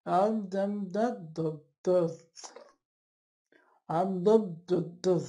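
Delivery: slurred syllables Um, dum, da, da, da with a limp tongue; patient who cannot move the tongue; flaccid dysarthria demo. Shown as a demonstration. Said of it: "I'll pronounce like this".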